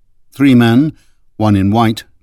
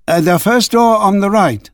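calling ahead, pitched higher; lightly voiced call to the guests ahead; 10 semitones higher than usual. A narrator's reading. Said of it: "he called ahead".

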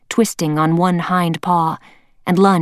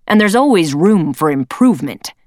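louder, cutting leader voice; stern leader voice; 5 dB louder than usual. Acted. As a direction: cut him off coldly. Leader cuts him off.

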